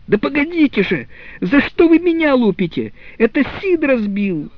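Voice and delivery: high, loud howl of protest; boy's outcry. Acted. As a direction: cry out in outrage, voice up high. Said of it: "Том возопил". (громкий возмущённый вопль)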